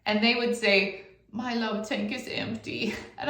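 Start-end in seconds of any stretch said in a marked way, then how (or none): 1.3-2.9 put-on mopey kid voice. Child's sad reply voiced.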